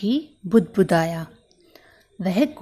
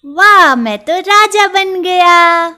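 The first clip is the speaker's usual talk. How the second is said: high, loud boast; a tiny mouse crowning himself; proud little mouse; 10 semitones higher, 10 dB louder than usual. Acted. Mouse preens in new cap.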